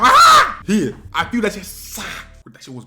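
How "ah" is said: very high, loud yelp; mock pain scream. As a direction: scream as the blow lands. Imitated yelp at being hit.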